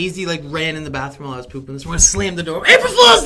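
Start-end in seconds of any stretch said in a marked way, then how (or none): 2.7-3.2 high, loud gleeful yell of April Fools; shouted prank. Retold or imitated. Daughter springing a bathroom prank on dad, loud gleeful yell.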